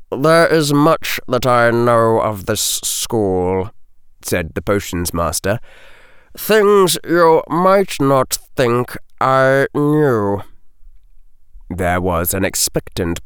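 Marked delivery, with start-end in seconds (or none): 0.1-3.7 slow, controlled character voice; hint at secrets, word by deliberate word; insinuating potions master. A narrator's reading. 6.3-10.4 very slow, higher on the hint; insinuating potions master. A narrator's reading. Potions master hints at secrets.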